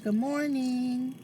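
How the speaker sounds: lightly voiced grandma, high and slow; a hint of grandma greeting you, slowly. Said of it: "Grandma said".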